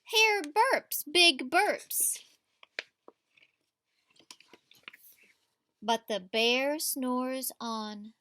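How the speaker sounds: lively read-aloud to a class; story reading to children. A narrator's reading. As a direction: read it like a picture book at story time. Teacher reads picture book aloud.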